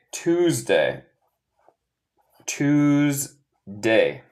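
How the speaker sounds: Tuesday drawn out with a long buzzing middle; slow model pronunciation. Shown as a demonstration. Teacher shows how martes is said in English, slow model pronunciation. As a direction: stretch "Tuesday" into two separate syllables. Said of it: "se dice".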